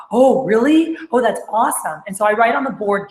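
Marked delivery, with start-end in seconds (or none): 0.1-2.0 swooping, enthusiastic surprise; excited reaction. Shown as a demonstration. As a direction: act out an eager rep reacting to great news. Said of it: "if you're not excited".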